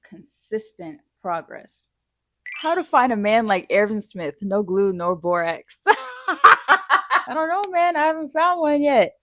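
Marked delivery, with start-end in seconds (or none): 5.9-9.1 laughing. Real, not acted. Laughs at the question.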